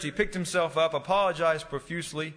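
normal talking voice

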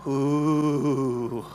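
imitating a crowd's low teasing ooh; a lightly voiced, drawn-out ooh from the whole room; mock audience ooh. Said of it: "everybody's gonna go".

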